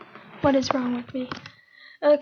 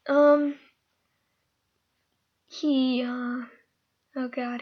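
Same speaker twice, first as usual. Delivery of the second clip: ordinary talk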